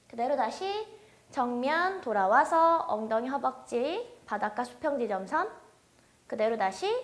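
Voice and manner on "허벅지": normal talking voice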